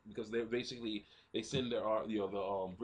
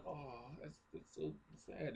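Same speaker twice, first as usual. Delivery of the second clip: soft, higher, mock-tearful sympathy; saddened reaction; 4 semitones higher, 8 dB quieter, slower than usual. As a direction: coo it softly as if moved. Reacting to the deathbed scene.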